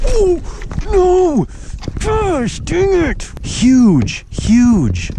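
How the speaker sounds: high, loud yelps of excitement; excited shout. Real, not acted. Angler shouts as a huge bass hammers his lure, thrilled. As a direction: yell in shock as the fish hits. Fish strikes and hooks.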